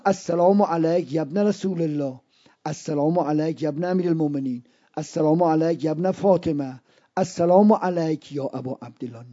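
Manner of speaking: low, soft recitation of a ziyarat; reverent salutation. Acted. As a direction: recite each greeting gently, in a hushed low register. (سلامِ زیارت، آرام و بم)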